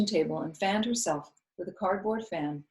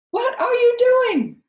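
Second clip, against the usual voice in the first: shriek; 12 dB louder, slower than usual. A narrator's reading. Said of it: "Viola charged at me".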